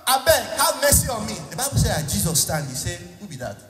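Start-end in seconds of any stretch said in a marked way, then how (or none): 0.1-1.3 begging blind man. Acted. Blind beggar crying out high to Jesus for mercy. 3.2-3.6 softer, lower voice of Jesus; hushed question. Acted. Preacher quietly voicing Jesus asking who called.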